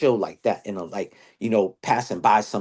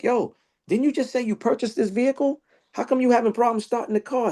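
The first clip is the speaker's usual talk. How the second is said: much higher, fast, disbelieving challenge; exasperated question; 12 semitones higher than usual. Retold or imitated. Asking his friend why the new car won't start, high and fast. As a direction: needle him about the new car, pitched way up.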